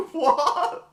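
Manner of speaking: high, loud laughing "what?"; real burst of high laughter. Real, not acted. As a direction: crack up mid-word, pitch shooting up. Cracks up at own botched attempt.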